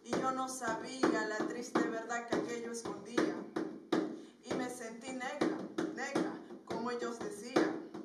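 dramatic spoken-word poem with rhythmic refrains; projected, deliberately paced verse; poem recitation. Acted. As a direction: recite a burdened memory, broken by chanted taunts.

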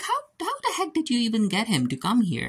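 high put-on voice, disbelieving; baffled George. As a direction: squeak out the disbelief. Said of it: "And George is like".

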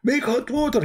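high-pitched mock holler, volume held in; mock scream. Husband showing off to his friend, mock scream. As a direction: imitate a husband screaming an order. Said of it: "he screamed".

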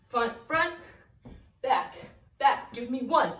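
ordinary talk

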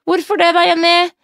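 lightly voiced grandma prompting Jenny; imitating grandmother. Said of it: "sier mormor".

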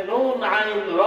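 "নুন আইন র" slowly, each letter apart; Arabic letter names. Shown as a demonstration. Preacher spells the root, "নুন আইন র" stretched letter by letter. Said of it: "grammar দিয়ে সুন্দর করে বলবি".

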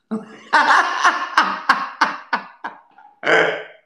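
loud, high burst of laughter; cracking up helplessly; big real laugh. Real, not acted. Fans watch him burst out laughing, loud and high. Cracks up at his own story.

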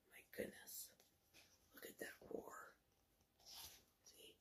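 barely audible whisper; hushed aside. Real, not acted. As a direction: whisper in awe at the picture.

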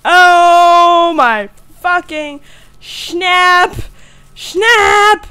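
long drawn-out, high, loud exclamation; loud fright. Real, not acted. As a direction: stretch each word in a shaken yell. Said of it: "I get so loud when I'm scared".

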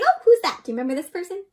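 ordinary delivery, nothing marked

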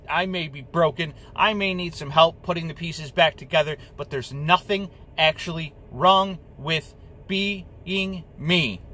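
ordinary delivery

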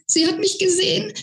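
giddy panicked squeal, lift at the end; squeaking. Acted. Flo squeals that his fairy saw him, high and rising. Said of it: "quiekte er".

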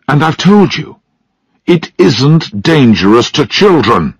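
insist, patience running out; exasperated insistence. A narrator's reading. Father insists during the quarrel.

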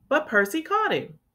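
usual speaking voice

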